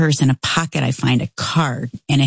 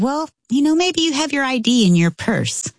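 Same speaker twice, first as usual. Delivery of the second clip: coaxing; 9 semitones higher than usual. Retold or imitated. Coaxing confused crying woman.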